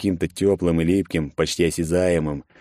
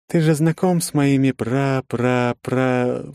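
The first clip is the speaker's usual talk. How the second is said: halting slow voice; 7 semitones higher, slower than usual. A narrator's reading. Alakan speaks, stammer trail.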